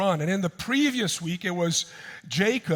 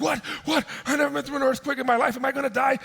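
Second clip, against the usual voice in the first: high, rushed mock panic; hammed-up nervous freak-out; 9 semitones higher, faster than usual. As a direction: imitate a man panicking in an earthquake. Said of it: "So he's all nervous".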